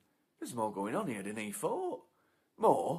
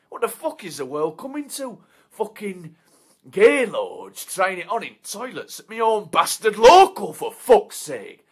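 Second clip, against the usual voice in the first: loud, higher ranting; furious rant; 9 semitones higher, 18 dB louder than usual. Acted. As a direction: tear into him. Angry rant at bloke.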